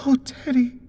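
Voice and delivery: high, thin frightened plea. Acted. Wife pleads with her husband, high and thin. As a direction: quaver it thinly. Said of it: "voice was as thin as water".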